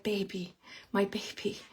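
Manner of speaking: put-on soft, tender baby; hushed, loving baby talk; tender coo. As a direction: coo it softly like a grandmother. Spirit grandma cooing to baby.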